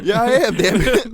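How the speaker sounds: high, loud mock outburst; indignant. Veteran acting out retort to doubters, mock indignant. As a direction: insist loudly, voice pitched right up.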